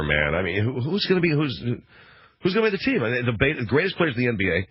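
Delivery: normal talking voice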